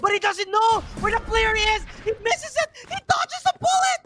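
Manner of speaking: fast rising play-by-play, building; esports caster. Acted. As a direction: call the round as it unfolds, tension climbing.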